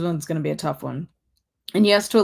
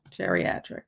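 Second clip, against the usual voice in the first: geriatric breathed out as a whisper, much quieter; whispered echo; 12 semitones lower, 7 dB quieter than usual. Shown as a demonstration. Repeating geriatric to chat in a whisper. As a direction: whisper the word geriatric back to herself. Echoing the word.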